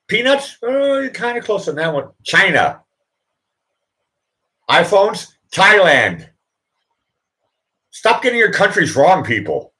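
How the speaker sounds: high, loud radio host voice; Rush Limbaugh impression. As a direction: bark it like a pushy talk show host. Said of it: "That was me pretending to be Rush Limbaugh".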